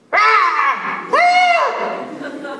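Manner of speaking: loud, high-pitched screams; two piercing cartoon screams; acted screams. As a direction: scream as if suddenly ambushed. Character attacked in pitched scene.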